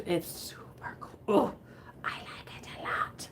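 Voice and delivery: hushed, slowed-down whisper. Real, not acted. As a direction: whisper it like a secret delight. Excited about a print.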